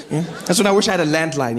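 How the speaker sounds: normal talking voice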